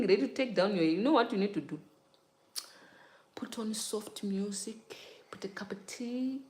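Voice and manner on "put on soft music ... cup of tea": soft, higher, airy near-whisper; soothing. Shown as a demonstration. Telling viewers how to prepare calmly, hushed. Setting a soothing self-care scene.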